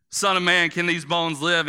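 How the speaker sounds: preacher voicing God calling to Ezekiel. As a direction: boom it down from above. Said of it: "God was calling down to you and said".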